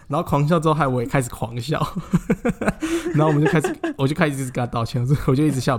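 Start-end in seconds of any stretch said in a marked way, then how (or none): 1.8-3.1 laughing. Real, not acted. Both crack up laughing.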